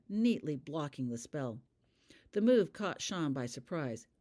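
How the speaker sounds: usual speaking voice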